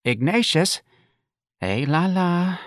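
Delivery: high, mildly woeful lament; a touch of sadness, voiced high; sad mother. A narrator's reading. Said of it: "Missus Riley said sadly".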